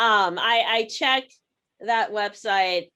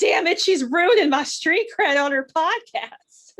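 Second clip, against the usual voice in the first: higher, louder mock bro voice; imitating Zach; 5 semitones higher, 4 dB louder than usual. Imitating her friend's annoyed reaction, mock complaint. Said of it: "He's gonna be like".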